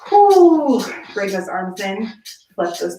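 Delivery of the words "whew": relieved whew. Real, not acted. End of hold set.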